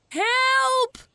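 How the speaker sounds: high, loud, slow cries for papa; boy faking panic to fool papa; calling for help. A narrator's reading. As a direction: yell across the farmyard. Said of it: "roept hij".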